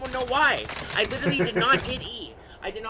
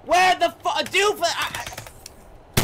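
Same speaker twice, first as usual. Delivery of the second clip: loud, high-pitched outburst; real yell of disbelief, cutting off mid-word; shocked shouting; 8 semitones higher, 6 dB louder than usual. Real, not acted. Gamer cursing at what just happened, shocked shout.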